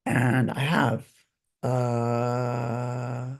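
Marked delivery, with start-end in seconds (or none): 1.6-3.4 a small sob breaking through; the voice catching as tears come; real tearful catch; real crying. Real, not acted. Breaks down recalling father.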